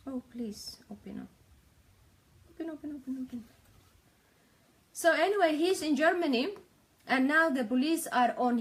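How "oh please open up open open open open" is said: soft whisper coaxing a stuck screen; whispered pleading. Real, not acted. Streamer urging her video to load, impatient whisper. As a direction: whisper open open under your breath, impatient.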